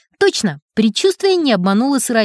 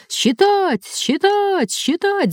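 much higher, clamouring chant; clamouring fans; 10 semitones higher, much slower than usual. A narrator's reading. Fans insisting the run should count, clamouring. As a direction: clamour like a noisy crowd. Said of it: "загалдели болельщики".

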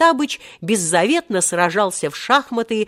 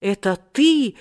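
high, emotional exclamation; rising, astonished joy; overjoyed cry; 6 semitones higher than usual. A narrator's reading. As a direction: cry out to a long-lost brother. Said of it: "вскричал в свою очередь Омар Юсуф".

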